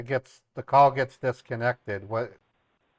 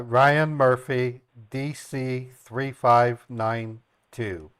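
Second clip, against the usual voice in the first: slow, deliberate read-back; reading back slowly; much slower than usual. Acted. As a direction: confirm each digit carefully.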